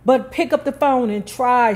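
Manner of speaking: lightly voiced holler from the stairs. A narrator's reading. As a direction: call it back up the stairs, half-hearted. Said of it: "she hollered back at them".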